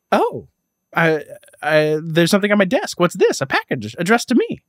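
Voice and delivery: high-pitched hammed-up discovery; feigned wonder at an unexpected find; mock surprise. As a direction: act out an overdone, theatrical surprise. Hammed-up retelling of character's surprise.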